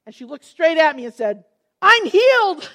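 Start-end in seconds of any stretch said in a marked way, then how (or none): 1.8-2.7 high, loud, bursting with joy; "I'm healed" cried out high and loud; joyful exclamation. Retold or imitated. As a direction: announce the miracle in amazement. Healed woman exclaims to speaker.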